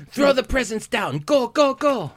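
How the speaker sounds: high mock German commander; German Blitzen. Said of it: "Sounds German".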